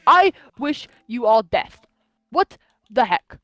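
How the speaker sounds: normal talking voice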